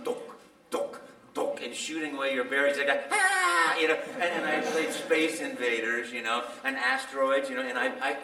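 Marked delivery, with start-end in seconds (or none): 0.1-1.6 shooting sound. Retold or imitated. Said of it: "he's going". 3.1-3.8 high, loud mock yelp; sudden high yelp of defeat; startled cry. Losing at game.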